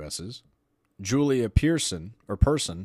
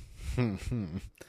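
short laugh; 2 semitones lower, 5 dB quieter than usual. Real, not acted. Laughing at study list.

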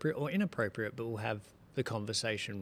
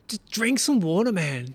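loud, high-pitched retold outburst; fed-up dad raising his voice; exasperated yelling; 6 semitones higher, 9 dB louder than usual. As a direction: snap at a stubborn child, re-enacted. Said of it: "sorry for yelling at you last night".

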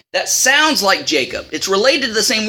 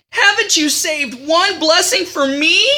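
pleading Esau; 8 semitones higher, slower than usual. Retold or imitated. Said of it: "Then he says".

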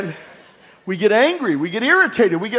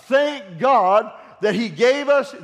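normal talking voice

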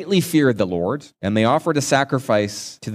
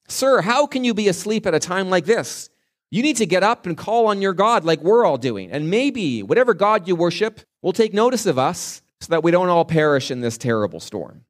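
higher, urgent captain pleading with a sleeper; worried ship captain; 6 semitones higher than usual. Retold or imitated. Captain rousing the sleeping prophet in the storm, high and urgent. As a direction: shake him awake with the captain's alarm. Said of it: "asking him".

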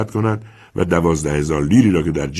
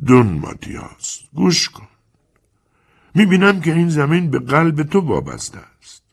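sinister tone; 8 semitones higher than usual. Acted. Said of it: "با لحن شومی گفت".

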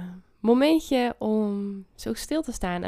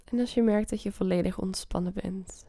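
guided meditation. Acted. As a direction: ease them into calm. Leads a meditation.

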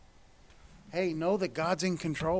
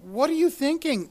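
higher, louder, scolding the Israelites; exasperated rebuke; 7 semitones higher, 5 dB louder than usual. Acted. Preacher scolding the Israelites, exasperated and louder. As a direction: chide them like a fed-up parent. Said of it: "talking to the Israelites".

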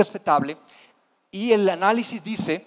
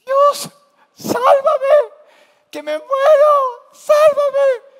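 much higher, louder, panicked mock shriek; hammed-up terrified scream; 10 dB louder, much slower than usual. Disciples screaming at the ghost on the water, hammed-up terrified shriek. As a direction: shriek like a grown man who has lost it.

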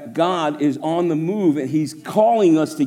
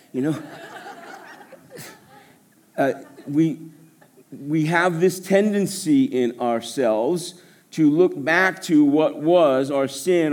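usual speaking voice

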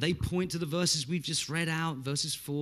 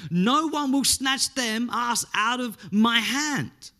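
slow, raised and louder preaching; emphatic declaration; 8 semitones higher, 6 dB louder, slower than usual. Acted. Preacher quoting Jesus' assurance to believers, emphatic. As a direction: declaim it slowly, every phrase driven home.

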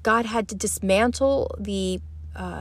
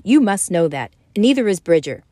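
firm, louder insistence; with conviction; 7 dB louder, faster than usual. Acted. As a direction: press the point home. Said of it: "Rogan said with conviction".

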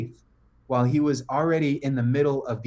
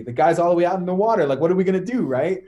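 panicked yell; 5 semitones higher, 7 dB louder, faster than usual. Retold or imitated. Disciples panicking at Jesus' call.

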